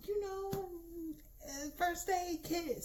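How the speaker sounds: very high, slow mock-teacher voice; imitating flustered teachers. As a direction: play a fussing teacher in a high-pitched dither. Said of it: "So they were like".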